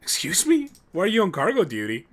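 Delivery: high, loud mock outrage; indignant surprise. Real, not acted. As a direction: act offended at the character. Indignant reply to game line.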